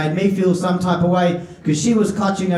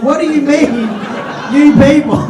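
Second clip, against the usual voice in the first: loud, raised-pitch indignant challenge; mock outrage; 6 semitones higher, 6 dB louder than usual. Comic confronting the old lady outside the lift, mock-outraged yell. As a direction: burst out as if deeply offended, for laughs.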